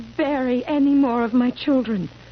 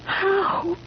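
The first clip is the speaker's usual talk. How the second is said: crying; 7 semitones higher than usual. Acted. Wife breaks down over dead children.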